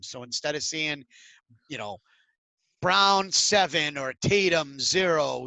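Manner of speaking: ordinary talk, nothing marked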